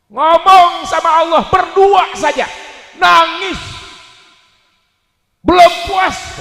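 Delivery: loud, high rally-style sermon; impassioned preacher's shout; full-force preaching. Real, not acted. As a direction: hammer each phrase out to the crowd.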